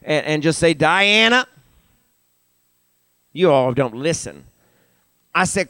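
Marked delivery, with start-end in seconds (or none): 0.8-1.5 higher, lifted call with a rise; name called out, pitched up at the end. Shown as a demonstration. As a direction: call the name across to a neighbor. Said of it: "call out somebody's name, right?".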